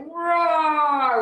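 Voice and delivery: high, loud roar of outrage; mock angry roar. Couple so upset at the lady, mock angry roar. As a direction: roar like you're comically worked up. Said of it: "We were so upset".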